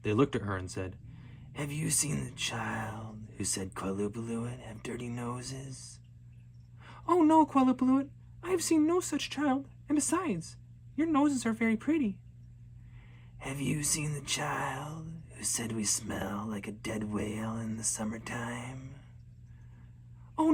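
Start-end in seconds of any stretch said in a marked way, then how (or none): 1.5-6.0 slow eerie creature whisper; whisper like blowing snow. Acted. Qualupaluit question the girl. 7.1-12.2 put-on high, eager little girl sweet-talking; nervous flattering girl. 13.4-18.9 slow eerie creature whisper; whisper like blowing snow. Acted. Qualupaluit question again.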